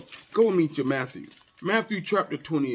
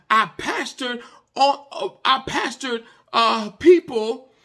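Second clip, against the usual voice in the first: higher, driving sermon voice; preaching at full force; 9 semitones higher, 5 dB louder than usual. Real, not acted. Preacher telling the congregation what God had to show him, preaching at full force. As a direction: preach it out with conviction.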